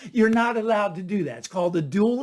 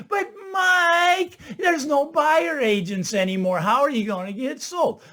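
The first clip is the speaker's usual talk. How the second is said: skeptical objector voice; 6 semitones higher than usual. Retold or imitated. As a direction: play the doubter cutting in. Imagined objector challenges the speaker.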